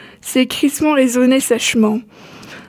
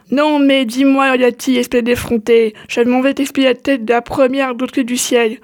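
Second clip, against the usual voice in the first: plain reading voice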